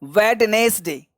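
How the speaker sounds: "वेडनेसडे" slowly, wed-nes-day; syllable-by-syllable demo. Shown as a demonstration. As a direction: stretch out each part of "वेडनेसडे" for pupils. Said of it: "तो इस तरह से निकालना". ("वेडनेसडे" धीरे-धीरे, हर हिस्सा साफ़)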